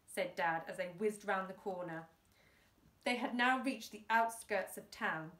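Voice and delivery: ordinary delivery, nothing marked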